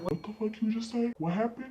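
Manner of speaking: soft, controlled questioning; cool, calm dad. Retold or imitated. Dad hearing she got jumped, quietly controlled. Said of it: "calm, and collective".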